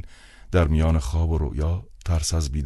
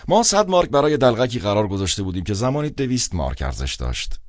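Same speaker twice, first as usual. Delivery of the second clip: put-on higher, relishing cold old man; cold sadistic tone; 7 semitones higher than usual. A narrator's reading. Director haggling down the clown's fee, coldly sadistic. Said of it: "لحن سردش بدل به سادیسمی ساده".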